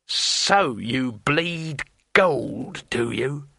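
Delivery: very slow, menacing hiss. Acted. Thief seeing coins fall from the wizard's robe, hissing. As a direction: draw the words out with menace. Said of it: "hissed Withel".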